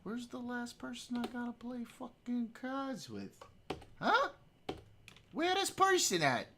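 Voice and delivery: high-pitched exasperated whine; high squeaky voice. Pretend. Exasperated squeaky complaint in game.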